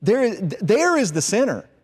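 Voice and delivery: single out the culprit with drawn-out emphasis; emphatic accusation. Acted. Fault-finding son pointing at the hut, accusing.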